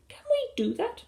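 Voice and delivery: very high, doubtful squeak; piping little-kid question; children's voice. A narrator's reading. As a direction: ask it like a small child. Children answering together.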